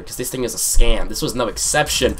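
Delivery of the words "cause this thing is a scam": "scam" punched louder; stress on scam. Real, not acted. Storyteller warning viewers about claw machines, loud stress on "scam". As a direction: hit the word "scam" hard. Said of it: "I emphasize the word scam here".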